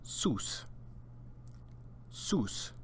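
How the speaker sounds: Sus said alone with a clear s; Spanish sus. Shown as a demonstration. Teacher says Sus for students to repeat. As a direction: model Sus crisply. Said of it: "repeat after me".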